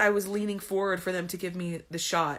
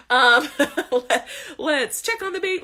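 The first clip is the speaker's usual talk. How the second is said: laughing nurse mimic; 5 semitones higher, 8 dB louder than usual. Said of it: "they just keep coming in".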